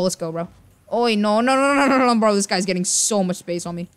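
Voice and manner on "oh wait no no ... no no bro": rapid string of panicked refusals, raised and loud; alarmed protest. Real, not acted. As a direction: cry out in rising alarm. Opponent gets space on him in game.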